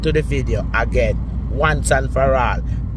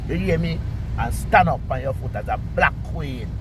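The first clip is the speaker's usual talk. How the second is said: usual speaking voice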